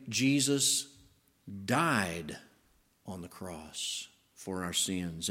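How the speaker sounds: "died" set apart between pauses; pause, land "died" on its own, then fall away softly; stress on died. Shown as a demonstration. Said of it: "you could put the emphasis on his, the work of atonement".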